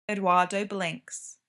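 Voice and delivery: ordinary delivery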